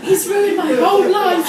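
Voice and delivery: loud, high, overwrought despair; melodramatic teen wail. Retold or imitated. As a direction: wail it like a heartbroken teenager. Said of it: "started crying".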